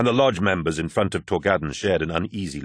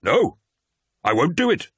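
a much higher, louder oath of refusal; sworn refusal; 9 semitones higher, 3 dB louder than usual. Acted. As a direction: plant your feet and refuse out loud. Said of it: "swore Torgadden".

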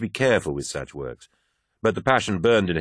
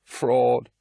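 put-on slurring the word drunkenly; slurred; 6 semitones higher than usual. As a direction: slur it like a drunk. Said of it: "she slurred".

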